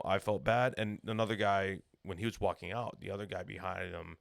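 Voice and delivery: ordinary talk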